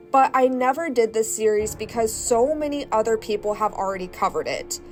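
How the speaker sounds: ordinary delivery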